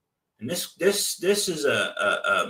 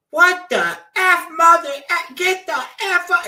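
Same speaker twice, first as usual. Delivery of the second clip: much higher, loud outraged cussing; furious screaming lady; 7 dB louder than usual. Retold or imitated. Woman in a robe screaming at door knockers. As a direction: lose it at the strangers on your porch. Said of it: "she just started freaking out".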